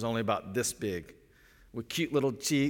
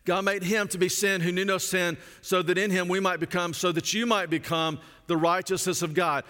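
raised, each phrase hammered home; stressed repetition; 5 semitones higher, 3 dB louder than usual. Shown as a demonstration. Pastor urging listeners not to miss it, emphatic. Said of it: "Don't miss that".

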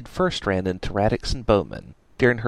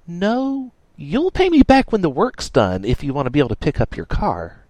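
firm and louder; stern tough girl; 8 semitones higher, 4 dB louder than usual. Acted. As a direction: lay down the terms. Dash's voice, read aloud.

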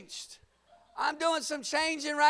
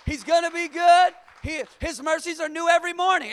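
ordinary talk, nothing marked

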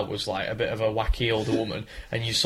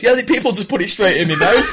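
higher, louder, laughing mock voice; imitating the bin man; 8 semitones higher, 7 dB louder than usual. Drunk man in the bin answering the cameraman, laughing. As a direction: play the drunk in the bin, cracking up. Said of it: "the guy in the bin goes".

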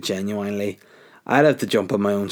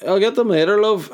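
high, louder sing-song promise to put it off; mock placating; 10 semitones higher, 4 dB louder than usual. Fobbing his partner off, high sing-song promise. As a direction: brush her off sweetly, far too high.

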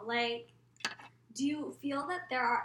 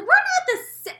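very high, loud squawk, cut off; outraged objection, breaking off mid-word; retold indignant denial; 12 dB louder than usual. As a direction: protest in a hammed-up shriek. Acted indignant denial, cut short.

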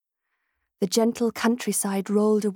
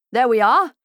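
hearty gentleman; 3 semitones higher, 9 dB louder than usual. A narrator's reading. Cheerful gentleman voice again.

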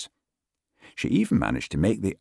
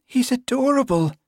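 very high, lilting smiling voice; 5 dB louder than usual. A narrator's reading. Constable fussing over delighted dog, smiling. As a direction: coo over the dog with a smile. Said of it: "Dorothy smiled".

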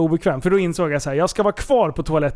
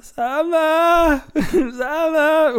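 high plaintive call for Sanna, half laughing; 9 semitones higher, much slower than usual. Retold or imitated. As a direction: whine her name from the floor. Calling out for partner.

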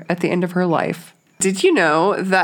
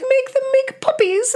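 normal talking voice

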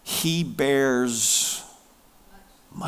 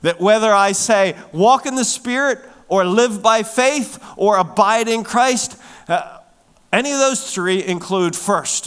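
raised, ringing preacher voice; full-force preaching; 6 semitones higher, 5 dB louder than usual. Real, not acted. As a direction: build and press each phrase.

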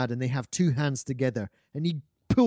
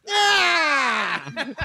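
high, loud comic rider's yell; whooping cry; 12 semitones higher, 4 dB louder than usual. Retold or imitated. Marcus Brody riding off wildly.

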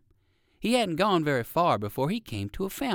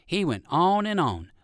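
plain reading voice

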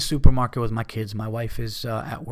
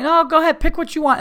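much higher, louder sing-song politeness; mock polite apology; 7 dB louder than usual. Mocking how the man should have apologized, high and sarcastic. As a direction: sarcastically act out the courteous stranger he wished for. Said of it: "Like a fucking".

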